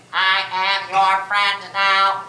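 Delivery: slow, low monotone toy voice; talking cardboard character. Acted. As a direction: recite it slowly, like a push-button automaton. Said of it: "he says".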